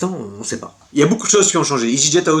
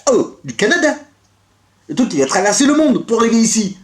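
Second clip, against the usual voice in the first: mock fond surprise; 6 semitones higher than usual. Voicing parcel from Canada.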